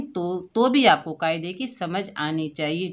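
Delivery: normal talking voice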